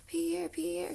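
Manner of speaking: high chanted cheer at moderate volume; storyteller's restrained crowd chant; mock crowd shout. A narrator's reading. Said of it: "shouted the crowd".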